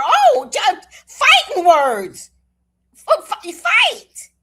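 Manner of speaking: high, loud, slow shout; excited shout. Real, not acted. Grandma reacting to fight, high loud slow shout. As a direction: belt it out thrilled.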